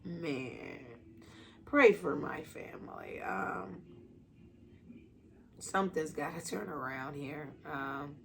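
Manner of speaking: real grief asking for prayers; choked up. Real, not acted. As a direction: hold back tears, quiet and low. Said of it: "getting a little choked up".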